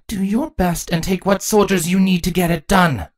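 high-pitched queen giving orders; commanding order. Acted. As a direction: order a soldier as his queen. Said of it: "Chrysalis ordered".

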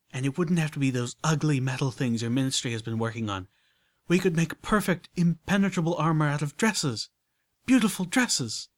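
high, loud, glowing with her idea; rapturous lady. Acted. Rarity pitching soul armor to Applejack, rapturous and loud. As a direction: sell the vision with rapture.

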